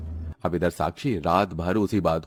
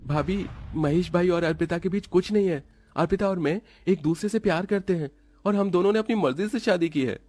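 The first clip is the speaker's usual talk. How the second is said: raised pitch, pleading explanation; earnest brother-in-law; 11 semitones higher than usual. Acted. Brother-in-law clearing up his sister-in-law's suspicion, earnest.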